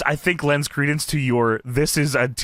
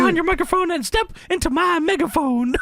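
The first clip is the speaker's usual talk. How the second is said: high, fast manager promo voice; brash loudmouth manager; shrill gloating wrestling manager; 11 semitones higher than usual. Retold or imitated. Reading Jimmy Hart promo.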